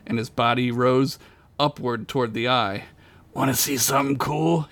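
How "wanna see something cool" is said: mild, strained call; yelled bravado. A narrator's reading. Dying Duncan calls to Gunk, mild strained yell. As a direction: call out a last bit of bravado. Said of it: "he yelled to Gunk".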